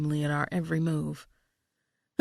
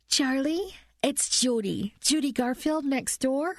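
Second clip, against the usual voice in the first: higher, fussy neighbor on the phone; neighbor woman voice; 7 semitones higher than usual. Acted. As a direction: play the anxious neighbor, pitched up.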